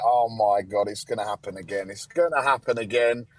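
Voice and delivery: mock dread, pitched low and hushed. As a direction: mutter it like doom is coming. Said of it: "we were like".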